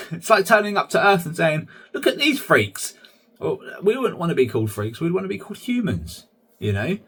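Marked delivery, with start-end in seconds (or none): 1.9-3.0 high-pitched mock gawping; mock astonishment. Visitor turning up to Earth and spotting humans, mock astonished. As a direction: exclaim it high, as if pointing at oddities.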